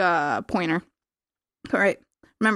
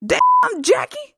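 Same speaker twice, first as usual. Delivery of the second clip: high, loud cartoonish squawk; badly hammed-up impersonation, pitched way up; terrible Kelso impression; 4 dB louder, much slower than usual. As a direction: shriek it like a bad impressionist. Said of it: "That was a terrible impression".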